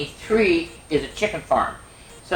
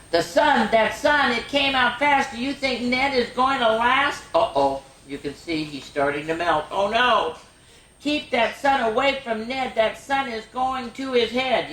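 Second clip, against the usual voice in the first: higher, lively picture-book reading; storybook drama, higher pitched; excited read-aloud; 9 semitones higher than usual. Acted. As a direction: sound the alarm for the kids. Reads rhyming snowman book aloud.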